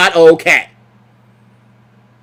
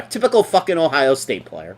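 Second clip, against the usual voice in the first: normal talking voice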